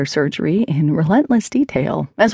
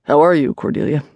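disinterested; 2 semitones lower than usual. Acted. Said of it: "with the same disinterested tone".

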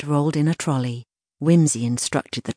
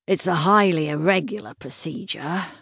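resigned sigh, drawn through the words; 3 semitones higher than usual. A narrator's reading. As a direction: say it on a reluctant sigh. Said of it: "he sighed".

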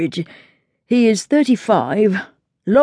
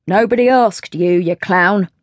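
mildly snappish butler; a touch of a snap; snapped. A narrator's reading. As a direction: cut the footman down, lightly irritated. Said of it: "snapped Rainbird".

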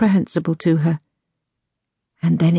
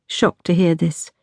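usual speaking voice

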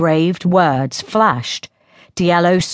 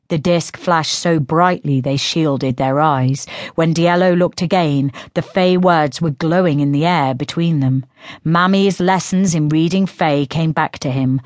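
ordinary delivery

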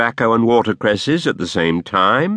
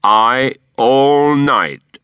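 louder, gloating answer; taunting retort; 4 semitones higher, 6 dB louder, much slower than usual. A narrator's reading. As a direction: throw it back at her with relish. Quilp taunts mother-in-law.

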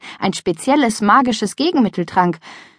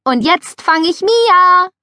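high, louder and slow; eager announcement; 11 semitones higher, 6 dB louder, slower than usual. A narrator's reading. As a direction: announce the next chase eagerly. Eager game call.

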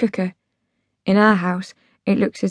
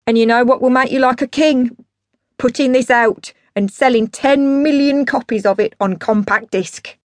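higher, excited boozy sales pitch; grandiose dad pitching; 4 semitones higher, 4 dB louder than usual. Acted. Dad selling his demo to Rock Perry, grandiose. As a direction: sell the dream of a fortune.

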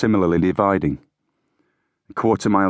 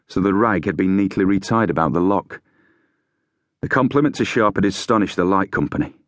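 ordinary delivery

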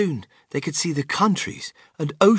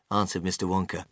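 ordinary delivery, nothing marked

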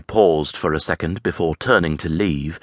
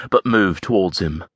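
ordinary delivery

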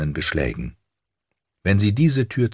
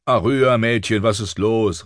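guard calling through a closed door; raised voice on the name, then easing; 5 semitones higher than usual. A narrator's reading. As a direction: call to the girl inside. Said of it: "rief er".

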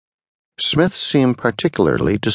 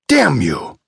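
screamed curse; 7 semitones higher than usual. A narrator's reading. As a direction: curse him in a raised, understated scream. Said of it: "he screamed at the old man".

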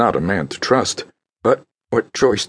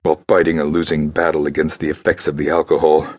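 plain reading voice

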